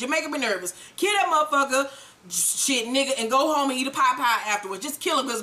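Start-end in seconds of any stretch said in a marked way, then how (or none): none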